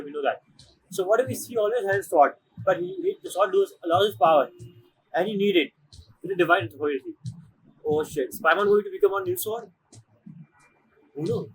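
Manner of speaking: normal talking voice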